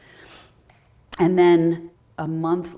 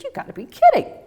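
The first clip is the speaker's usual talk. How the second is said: high, louder squeal of disbelief; amazed disbelief; 7 dB louder than usual. Retold or imitated. Reacting to her post going viral, high amazed exclaim. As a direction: burst out with amazed joy.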